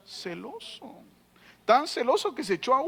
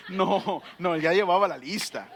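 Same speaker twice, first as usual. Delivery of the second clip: usual speaking voice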